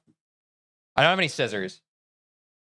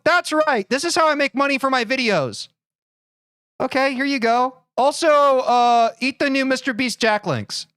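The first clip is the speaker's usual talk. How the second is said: higher, excited hyped YouTuber voice; Mister Beast impression; 6 semitones higher than usual. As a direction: pitch it like a hyped YouTuber robbing you. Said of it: "Mister Beast's voice".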